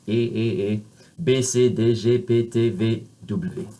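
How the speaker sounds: "e" pure and steady, then French letter names; French closed vowel. Shown as a demonstration. Teacher drilling the French alphabet, pure closed vowels. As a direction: recite the letters with one tense French ay. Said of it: "So you have the same sound there".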